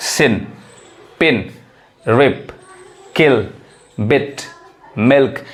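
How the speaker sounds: सिन, पिन, रिप, किल, बिट, मिल्क each quick with a short i; short vowel. Shown as a demonstration. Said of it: "fast पढ़ाता है".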